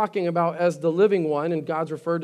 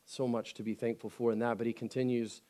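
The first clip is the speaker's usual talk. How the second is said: quiet sincere preaching; 6 semitones lower, 7 dB quieter than usual. Acted. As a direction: draw them in gently, almost confiding. Quiet reflective sermon stretch.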